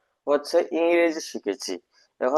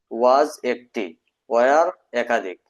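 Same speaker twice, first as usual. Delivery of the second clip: slow, stretched teaching drill; much slower than usual. Shown as a demonstration. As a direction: drill it slowly for learners. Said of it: "এই দেখেন". (ধীরে ধীরে পড়ানো)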